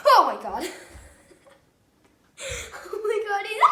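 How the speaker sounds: panicked shrieking with laughter; shrieking jackpot winner. Real, not acted. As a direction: squeal and laugh, high and loud. Cricket escapes, panicked shrieking laughter.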